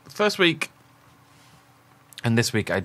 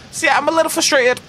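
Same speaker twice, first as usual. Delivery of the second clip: very high, louder squeak on little frustrated; mock squeaky complaint; 6 dB louder than usual. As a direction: squeak it out as a joke whine.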